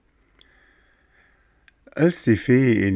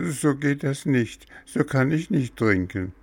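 put-on high-pitched, protesting child figure; high, fussy boy stalling over medicine; whiny puppet boy; 8 semitones higher than usual. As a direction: voice a stubborn little patient, high and insistent. Voices fussing Bengele.

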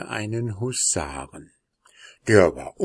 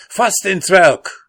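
lauter, hoher Befehlsruf; Ruf; 10 semitones higher, 11 dB louder than usual. Acted. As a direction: dem Pferd den Angriff zurufen. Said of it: "und rief". (der Husar schreit sein Pferd an, laut und hoch)

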